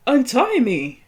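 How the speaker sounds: loud desperate cry to be untied; shouted plea. Acted. Tied-up man yelling at Karen to untie him, loud. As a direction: yell it like a bound man begging. Voicing tied-up Gregor pleading.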